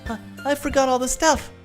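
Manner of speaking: high-pitched mock panic; panicked Sayori. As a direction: yelp it out in a flustered character voice. Sayori frantically realises she forgot.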